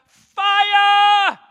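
high, loud pleading shout, slow at the end; shouting fire. Retold or imitated. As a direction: cry out for God's fire. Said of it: "we will shout".

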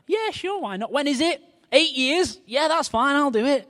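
high-pitched, carefree yes; breezy agreement. Retold or imitated. Acting out his past carefree agreement.

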